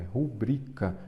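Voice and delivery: slow "rubrica", leaning on BRI; pronúncia correta. Shown as a demonstration. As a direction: teach "rubrica" with a strong middle syllable. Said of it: "a sílaba forte é o bri". ("rubrica" devagar, com o bri forte)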